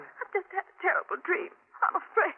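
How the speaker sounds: fearful voice after a bad dream; frightened wife. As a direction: shaken and scared, pleading for comfort. Wife wakes from nightmare.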